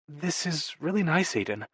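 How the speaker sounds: soft, lifted woman's voice from a narrator; tender thanks. A narrator's reading. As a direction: thank him gently and softly. Freya thanks Aiden in bed.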